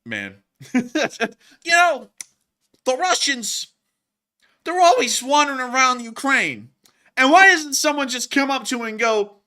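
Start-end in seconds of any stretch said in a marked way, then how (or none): 1.6-9.4 high, loud comic set-up; stand-up comedian. Acted. Mocking Russians wandering around Ukraine, in a high stand-up voice. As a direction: project it like a hammed-up club routine.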